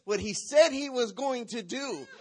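loud, slow preacher's proclamation; fiery sermon voice; preaching. Acted. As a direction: proclaim it over the church, drawn out. Preaching at full force.

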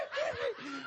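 high, shouted mock panic; hammed-up frightened cry; dramatic wail. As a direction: wail it like you're bleeding. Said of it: "I was so dramatic".

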